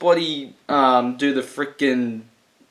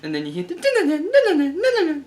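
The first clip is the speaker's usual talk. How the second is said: very high, slow mock cackle; goblin cackle; much slower than usual. Recalling the Goblin's laugh, a high hammed-up cackle. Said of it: "the goblin one going like".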